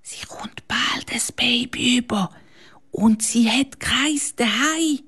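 whisper. Acted. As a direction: whisper it secretly into his ear. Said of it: "Dann flüstert sie am Janik ins Ohr".

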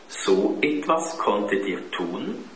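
usual speaking voice

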